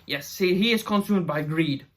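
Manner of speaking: usual speaking voice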